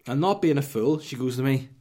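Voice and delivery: normal talking voice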